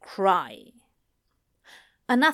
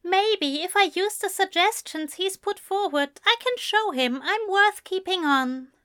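high-pitched, tentative musing; half to himself; 10 semitones higher than usual. A narrator's reading. Alistair musing on keeping his deal, half to himself. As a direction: think it aloud in a high character voice. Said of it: "Alistair said half to himself".

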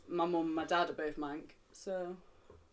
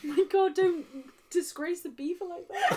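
laughing; 10 semitones higher, 3 dB louder than usual. Real, not acted. Laughing mock scolding of dirty line.

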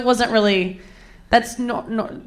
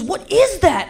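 retold bothered question, pitched up; 10 semitones higher, slower than usual. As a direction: imitate his perplexed complaint, IS jumping high. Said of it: "he seems bothered".

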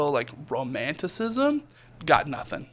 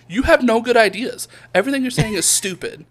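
mock scolding; 7 semitones higher, 4 dB louder than usual. Scolding buddy in truck.

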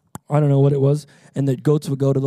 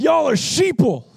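high, loud imitated holler; mock yell; 14 semitones higher, 7 dB louder than usual. Heated traveler yells at the security line, imitated shout. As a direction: re-enact the angry man's shout. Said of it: "He yells to all of us".

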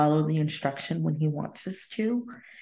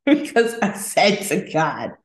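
ordinary delivery

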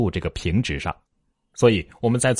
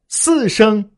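very high, louder syllables; very high key; 12 semitones higher, 5 dB louder than usual. Shown as a demonstration. Teacher showing any key is correct, very high key. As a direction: pitch the whole word right up. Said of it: "发音都是对的".

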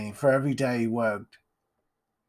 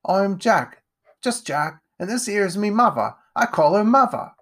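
put-on higher, cheeky child voice; little Jack; 9 semitones higher, 5 dB louder than usual. Jack introducing himself to the lord, childlike. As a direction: play an eager little urchin.

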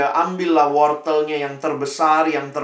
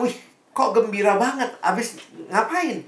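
higher voice, nosy and surprised; the friend asks the happy farmer, higher; curious friend; 6 semitones higher than usual. Acted. Teman heran bertanya. (teman penasaran, suara lebih tinggi)

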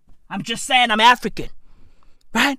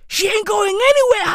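high quick quip; 9 semitones higher, faster than usual. Acted. Fast high punchline.

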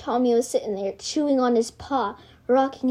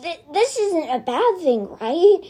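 nervous croak; 6 semitones higher than usual. A narrator's reading.

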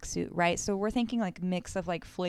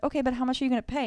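rapid businesslike; 5 semitones higher, much faster than usual. Retold or imitated. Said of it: "these women are like".

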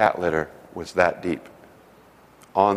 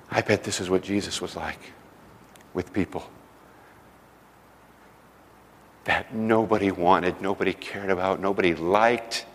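choked-up thought; 2 semitones lower, 5 dB quieter than usual. Real, not acted. His tearful inner thought.

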